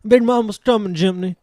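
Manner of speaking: high, loud character call; Big Mama. Acted. Game master voices Big Mama calling out.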